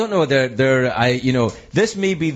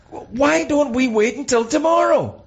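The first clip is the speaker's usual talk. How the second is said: higher, incredulous question; mock exasperation; 12 semitones higher than usual. Thinking back on the films, higher mock-incredulous question. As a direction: ask it as if it were obvious. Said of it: "I always thought".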